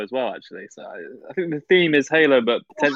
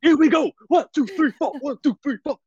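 hyped count-in; 12 semitones higher than usual. Pretend. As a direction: pump everyone up. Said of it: "where's that energy come from".

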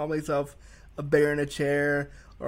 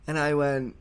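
normal talking voice

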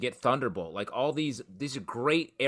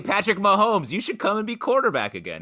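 coaxing recruiter; 9 semitones higher, 7 dB louder than usual. Retold or imitated. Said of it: "He's like".

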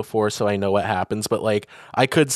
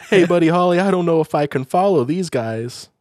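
high, louder quoted film character; lightly voiced movie character, raised pitch; film cameo quote; 7 semitones higher, 4 dB louder than usual. Acting film's celebrity cameo scene.

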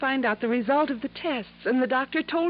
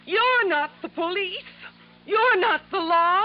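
higher, slow, insistent plea; pleading protest; 7 semitones higher, 3 dB louder than usual. Acted. Ginny begs Johnny not to arrest her uncle, pleading. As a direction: press each word, begging him to stop.

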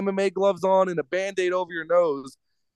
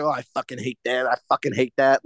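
lightly voiced mock whiny woman, a bit lower; a hint of a disdainful female fighter; mocking her; 6 semitones lower than usual. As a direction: sneer it in a put-on fighter's voice. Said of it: "she kept referring to the fans like".

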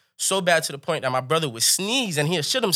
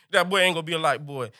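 ordinary delivery

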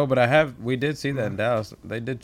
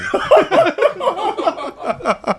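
loud high laugh; 5 dB louder than usual. Real, not acted. Bursts out laughing.

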